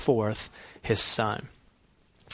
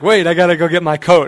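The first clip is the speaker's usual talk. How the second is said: loud, higher mock-urgent halt; sudden remembering; 4 semitones higher, 8 dB louder than usual. Dog owner stalls again for his coat, loud sudden remembering. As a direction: call a halt as you remember something else. Acts out forgetting coat at door.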